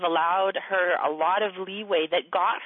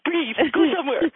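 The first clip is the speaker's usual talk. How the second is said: higher-pitched pleading orders; mock-urgent shooing someone out to breathe; short, insistent commands; acted exasperated urging; 7 semitones higher than usual. Acts out telling Aaron off.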